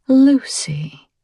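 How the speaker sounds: breathy whispered Lucy, savoring it; whispered name. A narrator's reading. Stalker whispering her name to himself.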